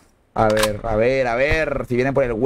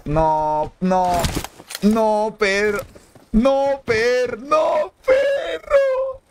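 drawn-out pleading, climbing into a high squeal; building wail; 8 semitones higher, much slower than usual. Real, not acted. Moved watching the downed player's teammate.

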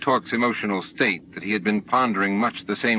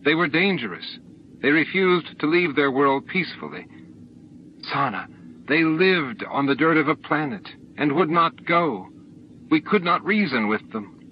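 higher-pitched, insistent pleading; raised, protesting explanation; defensive justification; 6 semitones higher than usual. A narrator's reading. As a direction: argue your case against an accuser. Defending himself in a heated quarrel.

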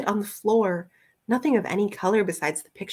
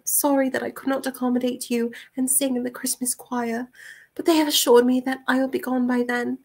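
higher, careful letter-reading voice; Rosie's letter voice; 6 semitones higher than usual. Acted. Rosie writing that she is unwanted, higher formal voice. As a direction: voice a formal young woman's letter.

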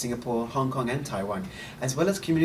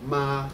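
"Ma" sustained level, without rise or fall; first tone. Shown as a demonstration. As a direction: model "Ma" high and flat for repeating. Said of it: "keep the pitch high and flat".

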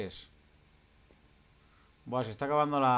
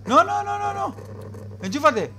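high, loud terrified screams; panicked screaming; 10 semitones higher, 5 dB louder, slower than usual. Real, not acted. Jump scare in horror game.

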